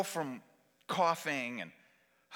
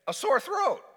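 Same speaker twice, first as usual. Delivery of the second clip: high, emphatic exclamation; mock horrified outcry; alarmed repeat; 12 semitones higher, 4 dB louder than usual. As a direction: make "sore throat" a high-pitched alarm.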